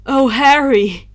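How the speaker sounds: slow, loud relieved cry; relieved exclaim. Acted. Remus rushing to Harry, slow loud relieved cry. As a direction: cry out his name in relief. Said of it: "Remus exclaimed".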